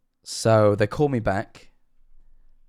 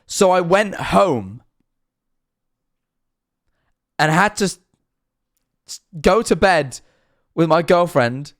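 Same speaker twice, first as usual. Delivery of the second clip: exasperated rant; 7 semitones higher, 4 dB louder than usual. Real, not acted. Exasperated climax of the story.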